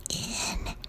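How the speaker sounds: a faint unnatural mimic; creature voice. The thing at the door asking to come in, in a soft inhuman voice. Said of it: "it just keeps saying".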